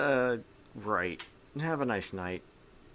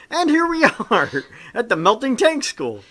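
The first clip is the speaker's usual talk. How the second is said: mock grand announcement; 11 semitones higher, 9 dB louder than usual. GM presenting the melting school to players, hammed-up announcer. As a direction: announce it like a theme park guide.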